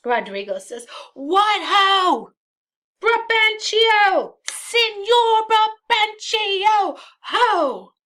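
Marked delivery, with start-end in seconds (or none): 1.1-7.9 high, loud drawn-out shouts; calling out, yelling. Acted. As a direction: raise the alarm as if the city were on fire. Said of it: "call out like the city is on fire".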